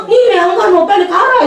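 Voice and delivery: high, loud Gyeongsang-flavoured shooing; a mildly mock gruff relative; brusque in-law. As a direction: shoo her back home. In-laws voiced telling her to go.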